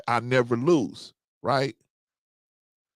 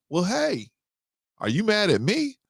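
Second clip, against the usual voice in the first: higher voiced girlfriend, lightly played; girlfriend's voice; 7 semitones higher than usual. Him imitating his girlfriend asking if he is mad, higher. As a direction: her asking if he's mad, mimicked up high.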